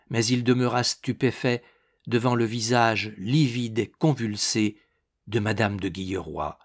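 usual speaking voice

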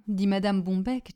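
usual speaking voice

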